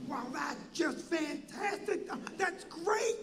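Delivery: slow, stretched wonder rising high. Retold or imitated. Entertainer reacts to club news, slow wacky amazement. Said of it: "these guys are a little wacky".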